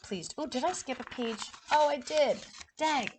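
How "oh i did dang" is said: plain reading voice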